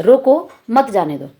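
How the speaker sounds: "रोको", pause, then "मत जाने दो"; slowly, breaking between रोको and मत; comma after रोको. Shown as a demonstration. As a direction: stress रोको, fall through "मत जाने दो". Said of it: "रोक लिया जाए".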